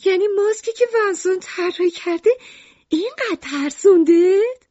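very high, incredulous question; astonished. A narrator's reading. Lucrece asks Ernest about Vincent's mask, astonished. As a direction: build to a loud, amazed finish. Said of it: "لوکریس با تعجب گفت".

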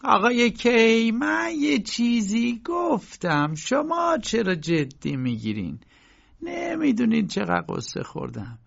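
high, slow, aggrieved landlady's outcry; a landlady wailing that she never gossips, high and drawn out; loud protest. Acted. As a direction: cry it out, hurt and on the verge of tears. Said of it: "با صدای بلند گفت".

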